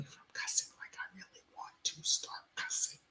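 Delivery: fast breathy whisper. Real, not acted. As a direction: whisper to hold back cussing. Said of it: "And I have to whisper".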